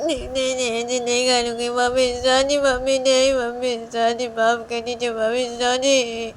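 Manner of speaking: put-on high, loud pleading child; frightened child begging. Liya begging her mummy not to punish her. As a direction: beg mummy for forgiveness. Child begs mother for forgiveness.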